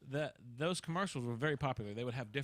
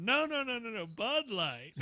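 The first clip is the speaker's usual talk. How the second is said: high, louder mock protest; commercial catchphrase; 9 semitones higher, 7 dB louder, slower than usual. Teenager quoting an ad to dad in church, playful mock protest. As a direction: start high on the no's, then stretch out the brand name. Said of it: "I leaned over to my dad, and I said".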